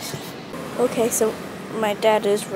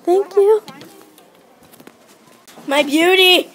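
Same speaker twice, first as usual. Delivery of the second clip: excited; 8 semitones higher, 6 dB louder than usual. Real, not acted. Greeting the new laptop.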